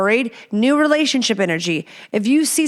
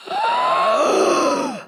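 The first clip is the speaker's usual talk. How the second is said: high sharp mock gasp; a hammed-up shocked gasp; dramatic gasp. Reacting to listener letter.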